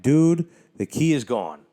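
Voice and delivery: exasperated. Retold or imitated. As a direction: snap back that it's gone. Exasperated at drunk friend.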